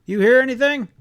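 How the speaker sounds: loud, high call into the wind; shouting. A narrator's reading. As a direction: yell across to grandfather through the storm. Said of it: "he shouted to my grandfather".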